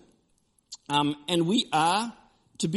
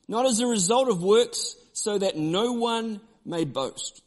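scripture reading; 5 semitones higher, slower than usual. Acted.

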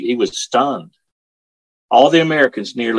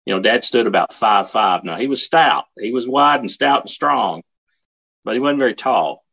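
ordinary delivery

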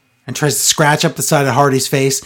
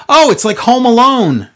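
higher-pitched imitation of a disbelieving commentator; mock commentator exclamation; 7 semitones higher, 4 dB louder than usual. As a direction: exclaim it, mocking the commentator's reaction. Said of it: "even Tom thinks this is so stupid. He's like".

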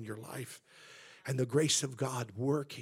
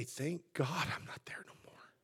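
hushed, much softer inward voice; private thanks to God; 8 dB quieter than usual. Acted. Preacher modelling thanks said within oneself, hushed. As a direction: say it under your breath, as to yourself. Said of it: "it's okay in yourself to say".

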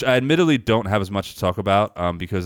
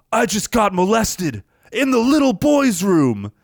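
higher, slow, oddly earnest line reading; strange sincere delivery; 12 semitones higher, 4 dB louder, slower than usual. Retold or imitated. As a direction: deliver it strangely and sincerely, like a bad B-movie actor. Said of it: "this is the line that he delivers very strangely and, and, like, sincerely".

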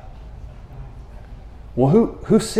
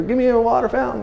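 mock plea; 9 semitones higher, 4 dB quieter, faster than usual. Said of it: "You're, you're".

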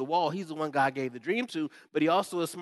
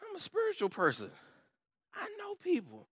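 smug insider; 6 dB quieter than usual. Retold or imitated. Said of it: "for all of you all that say".

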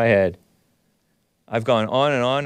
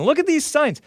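squeaked high, exasperated; mock outrage. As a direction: hit the top of the voice in disbelief. Repeat, even higher.

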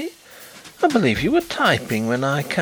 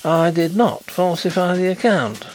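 indignant, higher-pitched protest; heated, emphatic rebuttal; hot denial; 8 semitones higher, 3 dB louder than usual. A narrator's reading. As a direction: deny it hotly, voice rising. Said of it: "cried Carlyle hotly".

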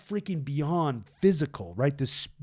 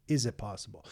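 softer and lower, a crushed father doubting; despairing doubt; 5 semitones lower, 6 dB quieter, faster than usual. Retold or imitated. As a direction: ask it quietly, almost without hope. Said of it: "This guy, from his perspective".

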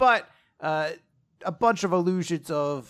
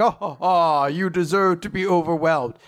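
louder, gloating villain boast; cocky; 3 semitones higher, 6 dB louder than usual. Acted. Demon Lord gloating at his foes, cocky. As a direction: crow it with hammed-up swagger. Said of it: "is very, like, cocky".

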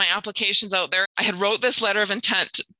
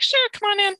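cheery neighbor; 13 semitones higher than usual. Retold or imitated.

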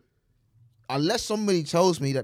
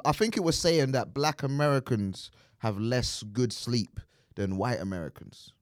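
ordinary delivery

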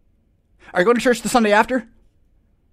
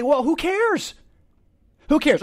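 higher, airy who-cares brush-off; dismissive shrug; 9 semitones higher than usual. Retold or imitated. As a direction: wave the panic away lightly. His dismissive answer.

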